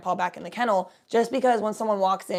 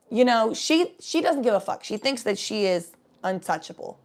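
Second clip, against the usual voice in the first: usual speaking voice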